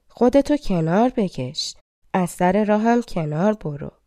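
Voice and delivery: ordinary delivery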